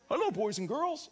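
hint of a children's TV host; imitating Barney. Pastor mimicking Barney greeting the children, lightly. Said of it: "Barney Christianity".

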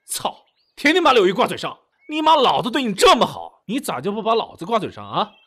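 high, heated ranting; jealous tirade. Acted. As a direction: rant out all your pent-up frustration. Jealous rant in quarrel.

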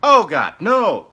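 loud, horrified groan of refusal; appalled. Acted. Aide appalled by the president's demand, loud dismay. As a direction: balk at it in horror.

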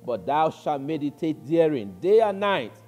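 loud, emphatic preaching; forceful scripture reading. Acted. Preacher reading Joshua to the congregation, loud and emphatic. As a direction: drive the verse home, loud and deliberate.

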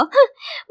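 sharp high mocking laugh; snooty high-pitched laugh; sneering laugh. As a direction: laugh down at her. ยิ้มร้ายให้.

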